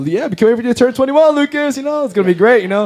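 high, rushed mock-excited parents. Parents cheering on their son before he turns twenty-one, excited. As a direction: gush eagerly, pitched well above your own voice.

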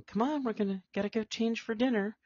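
high, hurried falsetto; quoting Daphne. Retold or imitated. Friend quoting Daphne rushing to dress, high falsetto. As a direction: hurry her off in a high mock-female voice. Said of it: "Daphne is, is going".

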